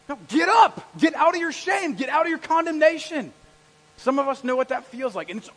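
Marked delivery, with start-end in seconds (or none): 0.1-3.3 loud, high rallying orders; commanding shout. Acted. Preacher as Jesus calling Paul out of condemnation, commanding shout. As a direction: shout them out of their shame. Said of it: "But Jesus says".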